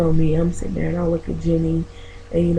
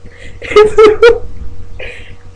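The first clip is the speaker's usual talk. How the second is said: high, loud burst of laughter; laughing; 13 semitones higher, 5 dB louder than usual. Real, not acted. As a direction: crack up at your own comeback. Cracks up at own comeback.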